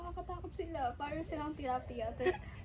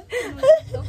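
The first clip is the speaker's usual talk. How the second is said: laughing shriek; 5 semitones higher, 19 dB louder than usual. Real, not acted. Fish nibbling feet, disgusted.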